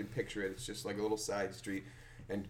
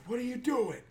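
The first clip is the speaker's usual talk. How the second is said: higher indignant demand; mock angry man; 13 semitones higher than usual. Angry driver confronting the kids, mock demand. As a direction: play the furious driver.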